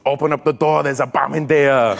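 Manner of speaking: put-on big Austrian-accented movie line; Arnold Schwarzenegger. Action hero warning of a bomb, Austrian accent. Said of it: "on an Arnold Schwarzenegger movie".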